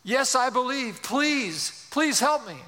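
high, acted pleading; pleading man. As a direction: beg the voice to save you, lightly hammed-up. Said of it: "The man says".

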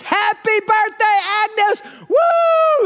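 high, loud, cheering yell; crowd yelling. Retold or imitated. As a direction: burst out cheering for her. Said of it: "They're all yelling and screaming".

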